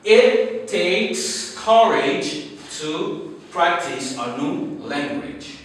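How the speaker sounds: slow, deliberate read of the motto; slow dictation. Shown as a demonstration. Teacher reading the motto aloud to class, slowly. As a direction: spell it out slowly for learners. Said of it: "Our M T for today is".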